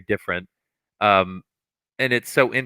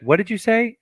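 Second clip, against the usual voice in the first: high, pointed teacher challenge; the classic teacher "what did you say"; mock stern teacher; stern teacher demand; 12 semitones higher than usual. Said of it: "I did the typical thing".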